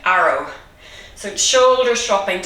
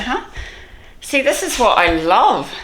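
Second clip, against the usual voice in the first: usual speaking voice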